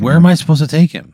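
put-upon, insistent complaint; exasperated. Pretend. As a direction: push the question, fed up. Player repeats the balking question.

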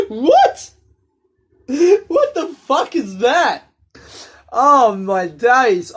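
high, loud incredulous laughing shriek; shocked disbelief. Retold or imitated. Reacting to pigeon hobby.

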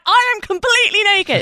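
high, loud, hammed-up horrified confession; exaggerated retold cry of dismay; mock panic. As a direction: act out panicked realisation, voice shooting up.

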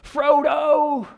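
high desperate shout across the water; imitating Sam's frantic yell; yelling Frodo. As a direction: call after him as he leaves. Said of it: "He's like".

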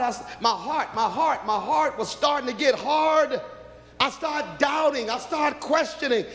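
high, pleading preacher voice; preaching testimony. Real, not acted. Preacher to congregation, rising impassioned plea.

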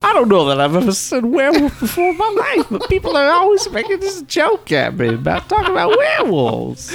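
John Travolta voice. Pretend. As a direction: squeak it out as the star. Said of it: "John Travolta".

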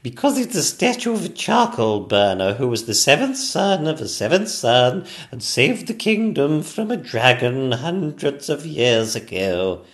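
high, loud put-on regal voice; a queen declaiming the statue's history; explaining Queen.

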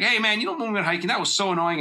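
high-pitched annoyed griping; mock complaint. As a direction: act out calling dad out, pitched well up. Imagined confrontation at family dinner.

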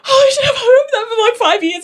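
high, tearful cry of relief; overwhelmed, half laughing half crying. Real, not acted. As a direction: voice cracking with emotion.

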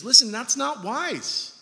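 usual speaking voice

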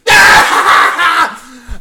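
loud high yell; imitated loud outburst. Imitating an audience member's outburst on stage, loud yell. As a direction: let out a sudden mock holler.

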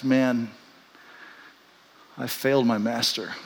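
low, hushed realization of failure; subdued, remorseful admission; quiet regret. Acted. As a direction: let it sink in softly, heavy with regret. Voicing Peter's sudden crushing regret.

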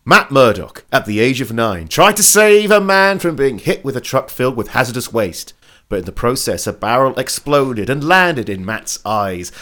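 lightly dramatic TV recap read; recap narration. Acted.

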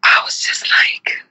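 whispered, as if overcome; awed whisper. Real, not acted. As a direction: breathe the words out in awe. Overcome watching protest video.

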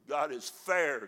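ordinary talk

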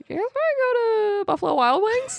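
high silly eagle voice. Retold or imitated. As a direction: pitch it way up as a bored eagle. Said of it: "they're like".

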